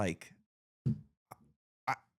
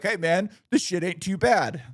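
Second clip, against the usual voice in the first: higher, louder mock customer, grudgingly pleased; approving customer; 8 semitones higher, 5 dB louder than usual. Customer tasting his drink at the bar, higher, louder grudging approval. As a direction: hand out a surprised thumbs-up.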